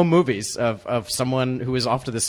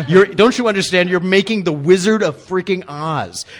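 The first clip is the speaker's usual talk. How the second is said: loud, raised-pitch incredulity; exasperated mock pleading; 5 semitones higher, 6 dB louder, slower than usual. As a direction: plead loudly, stretching the big title out slowly. Acted exasperated plea to grumpy crewman.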